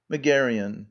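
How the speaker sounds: ordinary talk, nothing marked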